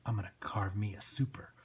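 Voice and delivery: low, soft villain threat; menacing thug. Acted.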